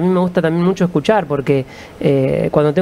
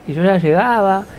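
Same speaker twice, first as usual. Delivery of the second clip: ordinary talk, nothing marked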